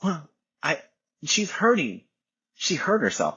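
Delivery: high, mock-anxious mom voice; lightly voiced worried mother. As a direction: imitate the fretting wife. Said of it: "She's like".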